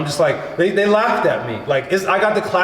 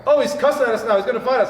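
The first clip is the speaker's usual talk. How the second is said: mocking tease; 7 semitones higher, faster than usual. Panel mocks his cursing.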